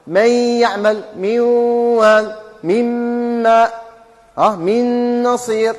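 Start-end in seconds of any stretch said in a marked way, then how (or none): 0.1-2.3 من يعمل من وال slow, nun nasalised into the next letter; recited with ghunna. Shown as a demonstration. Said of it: "تبقى الغنة تاعها". 4.4-5.7 من يعمل من وال slow, nun nasalised into the next letter; recited with ghunna. Shown as a demonstration. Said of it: "تبقى الغنة تاعها".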